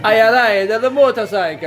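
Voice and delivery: a light mock Italian accent. Mocking Jay's Italian line in a light Italian accent. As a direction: tease Jay with a hint of an Italian accent. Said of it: "he's got a slight accent".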